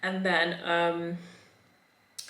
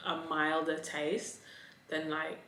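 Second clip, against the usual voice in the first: ordinary talk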